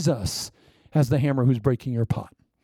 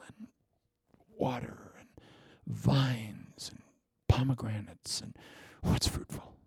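whispered, much softer, savoring each item; hushed whisper; 3 semitones lower, 10 dB quieter than usual. Real, not acted. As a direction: breathe the list out in awe, barely voiced. Dreamy list of holy land.

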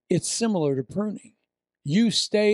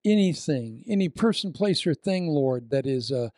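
prayer; 2 semitones lower than usual. Acted. Prays over the congregation.

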